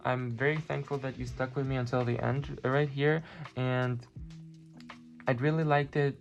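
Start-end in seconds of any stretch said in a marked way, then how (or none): none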